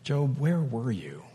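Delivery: quiet, tender question; softly asking Job where he was; soft and gentle. Shown as a demonstration. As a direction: ask it gently, like a loving father. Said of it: "And did he say".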